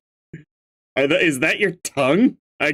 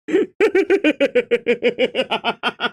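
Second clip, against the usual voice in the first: long high cackle; laughing; 8 semitones higher than usual. Real, not acted. Streamer cracking up at a YouTube chat message, high laughter. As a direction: burst into high-pitched giggling.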